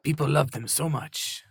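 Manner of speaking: mock Spanish accent, lightly put on; a cheesy fake Spanish accent; bad Spanish accent. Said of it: "Bad Spanish accent".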